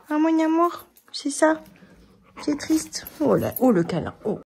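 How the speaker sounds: voix haute et douce pour la chienne; console-la tout doucement. Real, not acted. (voix tendre et aiguë)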